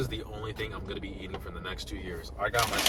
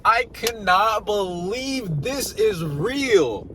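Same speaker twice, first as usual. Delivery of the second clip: higher and louder, slow with wonder; awed disbelief; 8 semitones higher, 5 dB louder, slower than usual. Real, not acted. Vlogger marvels to viewers at the new MacBooks, awed and slow. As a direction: stretch it out, stunned by the news.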